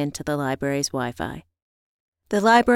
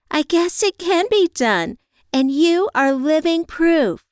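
high, louder, thrilled friend voice; excited friend; 12 semitones higher, 6 dB louder than usual. Acted. Friend praising Evie as living proof, high and excited. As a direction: gush with high-pitched admiration.

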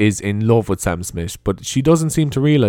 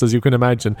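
normal talking voice

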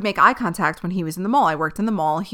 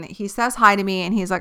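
ordinary talk, nothing marked

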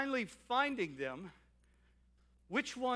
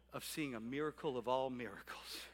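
usual speaking voice

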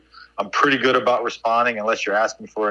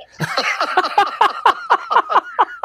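big laugh. Real, not acted. Laughs at coach's punchline.

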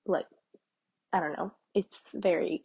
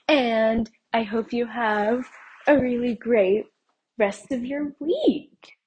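drawn-out sing-song goodbye; 5 semitones higher, 3 dB louder, slower than usual. Real, not acted. Sing-song farewell to listeners.

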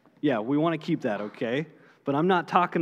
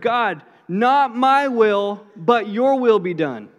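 slow, pleading prayer, a little louder; earnest prayer; 5 semitones higher, 6 dB louder, slower than usual. Retold or imitated. Jesus praying in the garden, slow earnest plea. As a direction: pray it out, drawn and earnest. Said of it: "starts to pray this prayer".

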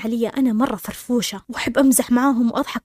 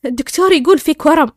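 higher and louder, incredulous announcement; loud, hysterical retelling; 4 semitones higher, 7 dB louder than usual. Retold or imitated. Stunned wife tells her husband the diagnosis, loud. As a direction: raise your voice as if imitating giddy disbelief. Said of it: "أنا صوتي عالي وأضحك".

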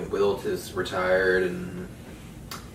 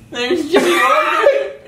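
much higher and louder, slow, through laughter; laughing shout; 9 dB louder, much slower than usual. Real, not acted. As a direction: announce it while cracking up. Spots picture, cracking up.

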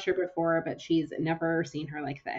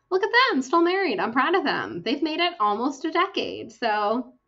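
higher and louder, gushing with delight; excited; 9 semitones higher, 6 dB louder than usual. Real, not acted. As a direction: beam with pride over the couple. Gushing over a fictional couple.

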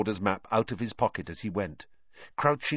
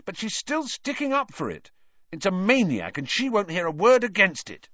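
very high, indignant complaint; exasperated, high-pitched rant; angry; 11 semitones higher, 5 dB louder than usual. A narrator's reading. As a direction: protest hotly, getting louder. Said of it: "Ron said angrily".

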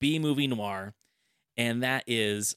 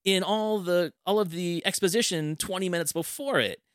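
baffled disbelief; 7 semitones higher than usual. Retold or imitated. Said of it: "And I was like".

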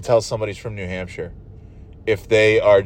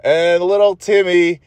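sing-song mock birthday announcer, higher; radio host shtick; 5 semitones higher than usual. Mocking the kids' radio DJ, hammed-up announcer. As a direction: ham up the DJ's birthday shout-outs, slow at the end. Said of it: "he does the same fucking shtick".

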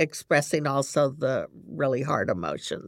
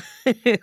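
short laugh; 7 semitones higher, 7 dB louder than usual. Real, not acted. Laughing at the stock answer "Fine.

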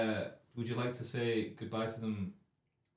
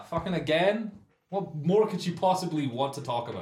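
mock exasperated; 6 semitones higher, 5 dB louder than usual. Exasperated at David texting again.

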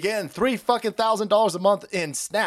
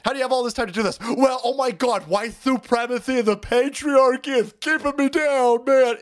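mock whiny commenter; 8 semitones higher, 3 dB louder than usual. Mocking an angry commenter.

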